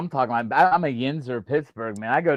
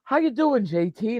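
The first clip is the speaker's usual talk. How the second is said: lightly imitated UK son-in-law, pitched up; mock UK accent; 7 semitones higher, slower than usual. Son-in-law greeting on FaceTime, mock UK accent. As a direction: lilt it high in a put-on UK accent. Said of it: "he'll start talking like".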